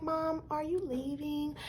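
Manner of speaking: put-on hushed little girl asking her mom; small daughter's voice. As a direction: ask it the way a shy child would.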